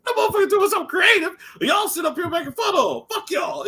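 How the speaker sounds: hammed-up friend defending his favourite rapper. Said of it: "He's like".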